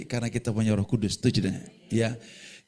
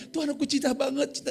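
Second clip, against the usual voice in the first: high-pitched imitation of a young woman in love; mock lovestruck youngster; gushing youngster; 12 semitones higher than usual. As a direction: gush like today's youth. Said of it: "kayak anak muda zaman sekarang".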